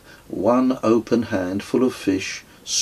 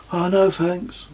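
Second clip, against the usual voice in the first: higher, lightly voiced young boy; boy declining his father's joke offer, in a higher voice; boy's voice; 10 semitones higher than usual. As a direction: play the polite little son turning it down. Narrator voices young son Kes.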